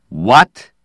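loud, sharply rising question; loud disbelief. A narrator's reading. Dowland hearing Slade's claim, loud disbelief. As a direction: burst out in incredulous surprise. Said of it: "Dowland said loudly".

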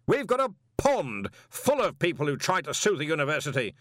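bellowing Arch-Chancellor; booming boast. Acted. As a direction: boom it across the room. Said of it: "Ridcully bellowed".

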